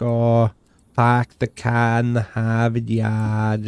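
put-on slow stock Boston accent, R's dropped; exaggerated Boston accent. Player mocking how everyone does Boston, exaggerated Boston accent. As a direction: imitate everyone's bad Boston accent, drawn out. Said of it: "No one can really do Boston accents".

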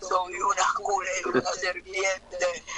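serpent demon voice. Retold or imitated. Demon answering the preacher, low slow serpent voice. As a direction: growl it low and slow like the snake. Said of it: "me dijo".

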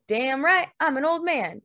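ordinary delivery

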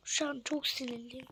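voiceless whisper, "çok" stressed; whispered anger. Real, not acted. Fan whispers to viewers how angry the loss made him. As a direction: hiss out the anger quietly.

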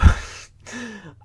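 sudden high burst of laughter; bright high laugh. Real, not acted. Laughs at own joke.

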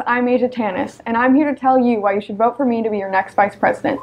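ordinary talk, nothing marked

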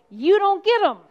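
loud, high, defiant declaration to Satan; defiant shout. Acted. Jesus defies Satan in sermon.

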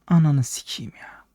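slow, unvoiced whisper of frustration; whispered curse. Real, not acted. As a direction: hiss the curse under your breath. Frustrated curse under breath.

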